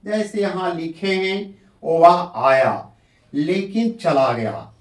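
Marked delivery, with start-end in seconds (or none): 1.8-4.7 slow वह आया, short pause, then लेकिन चला गया; लेकिन से पहले अल्प विराम. Shown as a demonstration. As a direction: pause briefly before लेकिन. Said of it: "लेकिन के पहले अल्प विराम दिए". (लेकिन से पहले थोड़ा ठहरकर बोलें)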